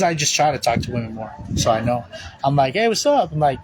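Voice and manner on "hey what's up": high, upbeat mock hello; chirpy retold greeting; friendly greeting. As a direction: greet a stranger brightly, pitched up. Voices own friendly greeting.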